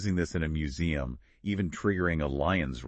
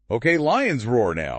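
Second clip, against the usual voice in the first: slow, louder playful order; mock command; 5 semitones higher, 7 dB louder, slower than usual. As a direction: bark out an order for fun, slowly. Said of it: "So I could be like".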